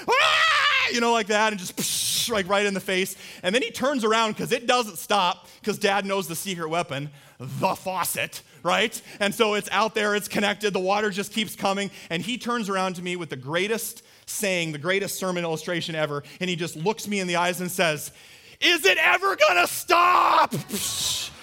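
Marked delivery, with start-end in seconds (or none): 0.1-0.9 put-on very high, loud kid's scream; imitated gleeful squeal. Son running from dad's hose, gleeful squeal. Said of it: "running around giggling". 1.8-2.3 hissed "psh" of water; imitated hose spray. Dad hosing son in the face, spray noise. 18.6-20.5 high, slow, drawn-out shout; imitated kid's wail. Son hosed down by dad, desperate shout.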